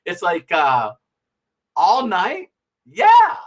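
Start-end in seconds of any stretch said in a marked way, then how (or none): 1.7-2.5 all night? with a rising, doubtful tune; incredulous question. Retold or imitated. Dialogue: question then answer. 2.9-3.4 much higher, louder eager yeah; emphatic yeah. Retold or imitated. Acted eager answer in dialogue.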